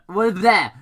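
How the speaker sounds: loud triumphant there; sudden exclamation. Acted. Narrator catching himself, loud and triumphant. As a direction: land it with a flourish.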